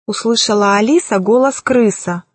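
ordinary delivery

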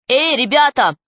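narrator's lightly acted yell; mild wild cry. A narrator's reading. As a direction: call out to the gang, not at full volume. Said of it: "раздался дикий крик".

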